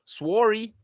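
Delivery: put-on "Sworry" said the Canadian way, a touch of Canadian accent; mock Canadian "Sworry"; Canadian sorry. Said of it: "As my Canadian brethren say".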